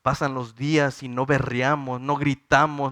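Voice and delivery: normal talking voice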